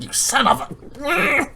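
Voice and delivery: high, slow cut-off curse; mock exasperation. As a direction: draw out a half-laughing curse. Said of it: "That's what it basically felt like".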